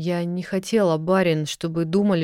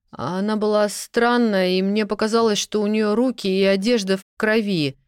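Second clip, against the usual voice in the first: flustered rambling; 4 semitones higher than usual. Acted. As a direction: tumble out a scared, confused account. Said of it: "сбиваясь".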